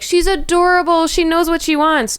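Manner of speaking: high, gushing coo; mock the fawning comments; a hint of a gushing TikTok commenter. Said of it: "all the comments were like".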